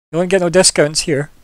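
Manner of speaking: cheeky captain refusing a discount. Acted. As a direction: tease the customer. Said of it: "She gives you a cheeky look".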